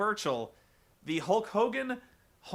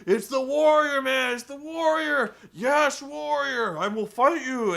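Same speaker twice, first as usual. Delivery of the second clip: higher, much louder frantic wrestler yell; Hulk Hogan yelling; 8 semitones higher, 7 dB louder than usual. Retold or imitated. A haunted wrestler shouting at a ghost, hammed-up yell. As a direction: scream the challenge like a crazed wrestler. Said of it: "And Hulk's like".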